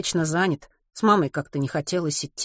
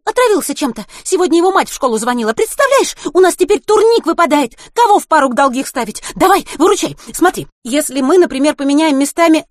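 fast, high, loud nonstop babble; rapid chatter; 10 semitones higher, 9 dB louder than usual. Acted. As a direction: rattle on without a breath. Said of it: "затараторила она".

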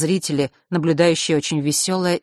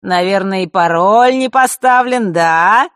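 mocking; 4 semitones higher, 9 dB louder than usual. Mark mocking triumph.

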